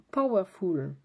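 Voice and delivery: a light English accent on "Powerful", said "pow-er-ful". Shown as a demonstration. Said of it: "je garde ma bonne résolution de mon accent anglais".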